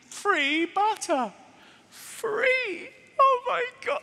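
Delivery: high, squealed, hammed-up awe; overwhelmed, very high-pitched disbelief; mock amazement. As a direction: marvel as if it were a miracle. Mock amazement at free butter.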